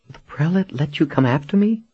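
high-pitched astonished question. A narrator's reading. Captive asks her rescuer about the prelate, astonished. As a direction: ask it in high-voiced disbelief. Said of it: "Janet blinked in astonishment".

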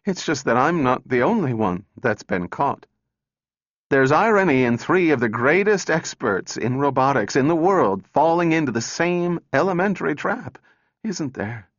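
high and strained, fading on the last words. A narrator's reading. Calvin tells the men they are caught, voice fading. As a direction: start high, let it fade at the end. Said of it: "Her voice faded".

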